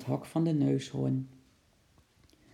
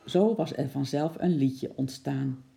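plain reading voice

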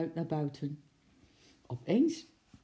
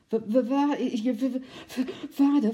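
breathless, stammering, higher and louder; panting stutter; 9 semitones higher, 7 dB louder than usual. A narrator's reading. Zookeeper out of breath, stammering to the girl at the door. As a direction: gasp out the words after running. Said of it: "vroeg hij hijgend".

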